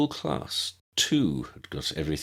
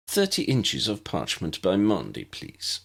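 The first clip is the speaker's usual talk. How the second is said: ordinary delivery, nothing marked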